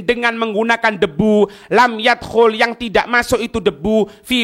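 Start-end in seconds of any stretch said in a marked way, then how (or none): none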